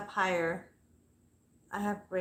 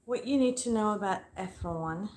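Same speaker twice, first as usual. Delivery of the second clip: ordinary delivery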